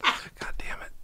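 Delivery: breathy under-the-breath curse; whispered curse. Real, not acted. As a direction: hiss it under your breath. Muttered aside.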